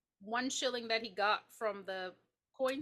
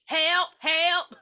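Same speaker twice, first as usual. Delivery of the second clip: loud, higher-pitched pretend plea; playful shouted emergency call; mock cry for help; 5 semitones higher, 8 dB louder than usual. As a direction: holler for help, hammed up.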